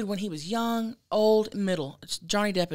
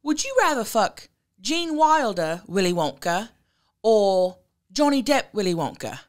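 put-on best British accent; 4 dB louder than usual. Host re-asks the England caller's question in a put-on British accent. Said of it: "this is my best British accent".

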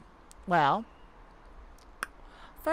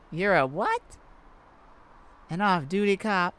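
ordinary delivery

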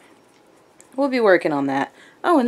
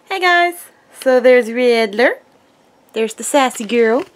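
ordinary talk

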